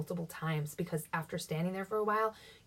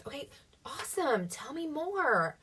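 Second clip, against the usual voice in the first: excited; 7 semitones higher than usual. Pretend. Encouraging a visitor.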